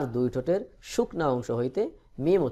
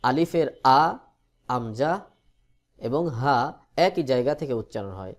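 usual speaking voice

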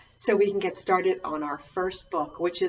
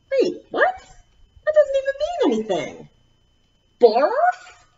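much higher, startled outcry; mock surprise. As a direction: play shocked at the silly words. Reader protests the book's words.

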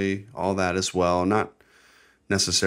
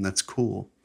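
ordinary delivery

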